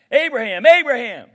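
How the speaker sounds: loud heavenly call across a distance. Acted. Angel calling down to Abraham, loud ringing call.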